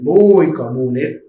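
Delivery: muy comunes said very slowly, stretched for weight; slow emphatic repeat. Shown as a demonstration. Teacher repeating a point slowly to drive it home. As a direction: drag out muy comunes to stress it. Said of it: "que son muy comunes".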